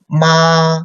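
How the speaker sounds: "Ma" held high and level, first tone. Shown as a demonstration. Teacher reading the four tones to students, high flat "Ma". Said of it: "let's read again".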